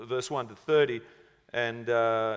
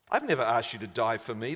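low, quick dismissive reply; mild contempt; 6 semitones lower, 4 dB quieter than usual. A narrator's reading. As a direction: toss it back with a touch of scorn. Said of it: "replied with contempt".

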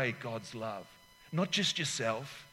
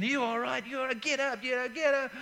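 higher, urgent voice of a mate; clamoring mates; 10 semitones higher than usual. Retold or imitated. Mates clamoring over a winded player, higher and urgent. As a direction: pull a winded friend to his feet. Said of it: "your mates are clamoring".

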